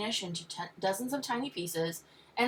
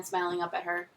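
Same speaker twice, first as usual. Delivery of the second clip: ordinary delivery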